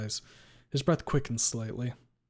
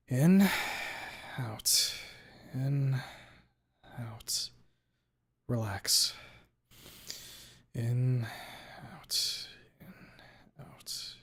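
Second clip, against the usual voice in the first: calming breaths; much slower than usual. Acted. Narrator talking himself down with deep breaths. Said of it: "Calm. Deep breaths".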